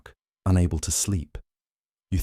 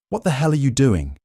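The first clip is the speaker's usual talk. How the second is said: angry shout, pitched high; 8 semitones higher, 4 dB louder than usual. A narrator's reading. Foreman yelling at the worker who dropped a crate, angry shout. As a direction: bark it at the worker. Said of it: "he shouts".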